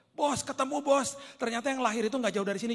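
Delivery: high, loud shout to the boss; teriak. Acted. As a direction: call out the discovery, imitating excited scribes. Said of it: "mereka teriak". (berseru lantang memanggil bos)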